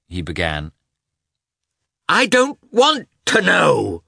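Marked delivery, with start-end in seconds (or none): 2.1-4.0 high, loud, slow near-scream; a wife shrieking that she refuses to hear. A narrator's reading. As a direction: cut him off, almost screaming. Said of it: "she almost screamed".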